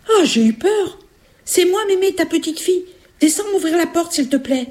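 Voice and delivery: put-on very high, relieved child voice; little girl. Little girl asking grandmother to open, high child voice. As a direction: plead sweetly like a small girl.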